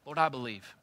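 loud, high, pleading confession; fervent prayer. Acted. Believer crying out to the Lord, loud fervent prayer. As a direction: pray it out with full force. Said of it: "you're gonna have to say".

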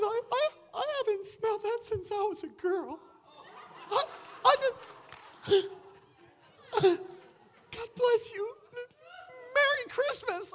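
hammed-up high, choked sobbing voice; imitated tearful woman. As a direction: stammer it like a woman overcome by tears. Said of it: "And she's crying".

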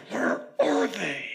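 high, drawn-out exasperated mutter; frustrated, under the breath. Retold or imitated. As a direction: imitate a fed-up parent muttering, very slowly. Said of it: "So I say under my breath".

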